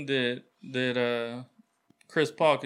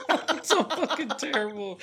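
laughing; 12 semitones higher than usual. Real, not acted. Laughing at friend's accent.

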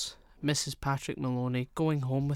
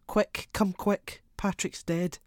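high, slow, frantic plea; panicked cry down the phone; frantic cry to the police; 8 semitones higher, 3 dB louder, slower than usual. A narrator's reading. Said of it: "she cried to him".

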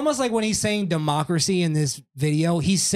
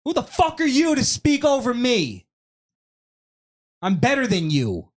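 arrogant angry; 9 semitones higher than usual. Retold or imitated. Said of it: "showing the real him".

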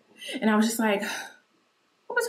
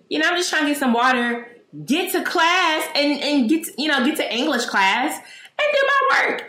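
put-on high, loud and indignant, as an upset kid; a child's raised, outraged complaint; indignant outburst; 5 semitones higher, 7 dB louder than usual. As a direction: protest loudly like a wronged sixth grader. Retelling her indignant sixth-grade outburst.

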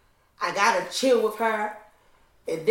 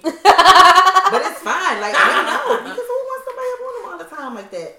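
laughing; 10 semitones higher, 12 dB louder than usual. Real, not acted. Laughing to friends about her clingy family. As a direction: laugh hard through it, pitched high.

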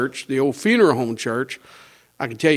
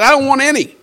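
high, raised-voice mock refusal; emphatic refusal; 10 semitones higher, 6 dB louder than usual. Turning down the steak dinner, high emphatic refusal. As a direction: re-enact turning it down, loud and high.

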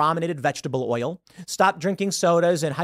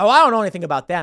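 mock dodge; 3 semitones higher, 6 dB louder, much faster than usual. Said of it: "And they're like".

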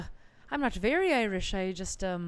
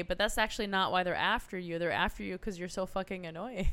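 ordinary talk

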